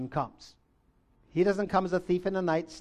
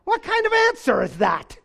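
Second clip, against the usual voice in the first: high, loud, baffled outburst; comically exasperated squawk; mock exasperation; 11 semitones higher, 5 dB louder than usual. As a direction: protest in hammed-up disbelief, voice shooting up. Acts disciples' baffled reaction.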